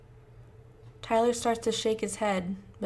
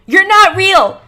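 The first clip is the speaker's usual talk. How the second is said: screaming; 11 semitones higher, 20 dB louder, slower than usual. Acted. Screaming match between them.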